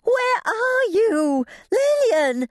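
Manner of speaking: very high, drawn-out holler; desperate, stretched high yell; frantic calling out. A narrator's reading. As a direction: call out in a panic for someone missing. Frantic calling through the house.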